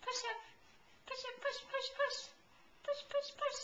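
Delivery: high, short rhythmic urging. Real, not acted. Urging the baby to stand.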